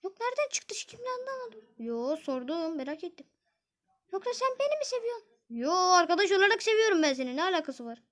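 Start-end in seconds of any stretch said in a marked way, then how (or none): 0.0-1.6 put-on girl voice. Zeynep is puzzled by the question. 4.1-5.3 put-on girl voice. Zeynep teases her friend.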